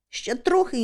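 ordinary delivery

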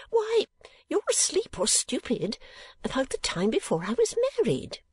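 high, exasperated mother voice; scolding mother. Acted. Mother chiding her forgetful daughter, high and exasperated.

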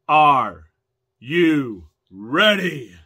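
loud, slow, drawn-out arena hype; ring announcer. Acted. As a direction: work up a wrestling crowd. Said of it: "become a ring announcer".

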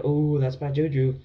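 slow, goofy quoted exclamation; silly quote voice. Retold or imitated. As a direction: ham up your own silly line. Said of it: "When I, when I said".

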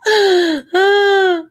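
loud high laugh breaking toward tears; loud crying laugh. Real, not acted. Vlogger howling with tearful laughter. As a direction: laugh until it nearly cries.

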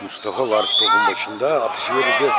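live sports call, measured build; play-by-play commentary. Acted. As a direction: call each penalty as it happens. Live penalty shootout commentary.

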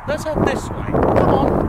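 rally yourself onward with a loud call; excited call to get going; eager call. Real, not acted. Setting off on the hunt.

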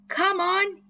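high, loud urgent yell; boy hurrying friends away, high yell. A narrator's reading. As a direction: hurry them along. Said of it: "yelled Chip".

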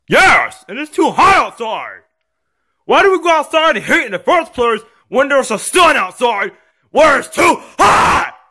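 put-on high, indignant kid yelling; screaming complaint. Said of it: "And stop screaming".

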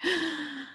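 high startled gasp of mock terror; sharp gasp. Showing the sheer terror feeling, a gasp. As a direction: gasp as if frightened.

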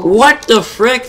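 high, slow shocked "what the frick"; drawn-out disbelief. Real, not acted. Shocked reaction during gaming stream.

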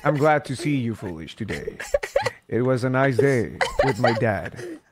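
deep, low-key put-on voice; very low, subdued small talk; Bobby. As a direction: speak low and measured, like a grown-up. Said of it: "But sometimes Bobby's like".

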